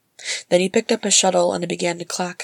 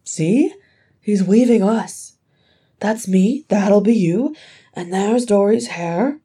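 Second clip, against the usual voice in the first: higher, louder, drawn-out eagerness; eager pointing out; 4 semitones higher, 6 dB louder, slower than usual. A narrator's reading. Ori showing his brothers in the weaving, eager. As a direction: point each one out with lifted, insistent voice.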